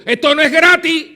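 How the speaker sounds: loud, high, hammered statement; forceful declaration. Acted. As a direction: drive the point home hard. Preacher hammers point.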